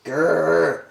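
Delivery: drawn-out "grr"; grumpy fish growl. Acted. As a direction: growl as a thwarted big fish.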